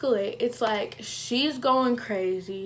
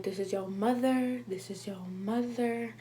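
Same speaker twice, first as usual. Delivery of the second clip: voice of God; 6 dB quieter than usual. Retold or imitated. God reminding her it's her mother, soft solemn voice. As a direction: speak softly as a calming God. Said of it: "God was like".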